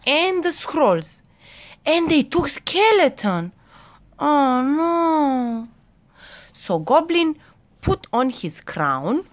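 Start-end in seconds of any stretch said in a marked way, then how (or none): 4.2-5.7 long, drawn-out high "oh no"; lightly acted dismay. Narrator reacts as skeleton is taken.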